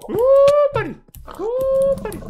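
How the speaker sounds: very high squeal; squeaky call. Real, not acted. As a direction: squeal it like calling a pet. Squeaky calls to an animal.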